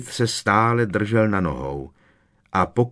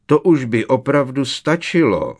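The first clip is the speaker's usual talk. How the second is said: higher, louder uneasy scolding; troubled reprimand; 5 semitones higher, 5 dB louder than usual. A narrator's reading. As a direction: scold the pupils, rattled and insistent. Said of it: "napomenul ji znepokojeně profesor Tofty". (znepokojeně a zvýšeným hlasem napomínat)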